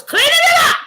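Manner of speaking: loud, high-pitched holler; comic shout. Retold or imitated. Woman recounts obeying the Father, high comic shout. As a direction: shout it high, playing up the panic.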